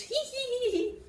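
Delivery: high squeaky giggle; high character giggle. Acted. Little frog giggling at his sister, high and squeaky.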